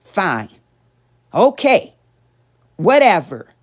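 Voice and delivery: loud, combative dismissal; verbal assault. Shown as a demonstration. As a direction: fire off each word as an attack. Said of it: "That's an assault".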